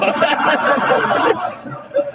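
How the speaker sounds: rising high giggle; high laugh. Real, not acted. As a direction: giggle up helplessly. Laughs at the punchline. (tawa cekikikan naik tinggi)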